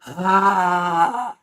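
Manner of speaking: long forceful "ah" with tongue out; open-mouthed, emptying breath-roar; lion's breath exhale. Shown as a demonstration. Coach demonstrates lion's breath for the class, forceful long ah. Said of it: "we stick the tongue out and we go".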